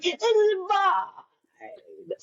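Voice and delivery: bold declaration. Retold or imitated. Walking into the audition, a slow grand declaration. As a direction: claim the room, stretching every word.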